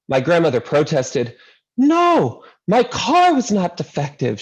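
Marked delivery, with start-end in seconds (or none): 1.8-4.4 put-on thick Greek accent. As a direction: cry it out in a Greek grandmother's accent. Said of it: "she cried in her thick Greek accent".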